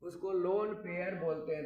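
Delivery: ordinary talk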